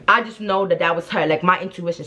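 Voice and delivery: ordinary talk, nothing marked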